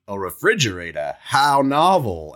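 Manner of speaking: put-on slow, amazed old-fashioned drawl; old-timey cowboy voice. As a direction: marvel at it like a frontier cowboy. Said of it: "He's gonna be like".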